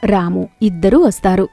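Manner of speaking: usual speaking voice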